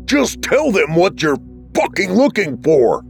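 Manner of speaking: high, furious outburst; angry scream. A narrator's reading. Jackson, head hurting, to the voice, screaming in anger. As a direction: scream it in pain and frustration. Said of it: "he screamed".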